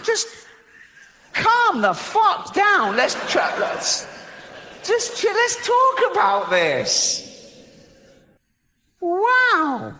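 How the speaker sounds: high and slow, pleading for calm; squeaky drawn-out exasperation; exasperated plea. Real, not acted. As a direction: beg an angry god to calm down.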